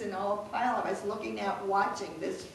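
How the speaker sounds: real amused laugh. Real, not acted. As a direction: laugh into the last word. Laughing at pony biting story.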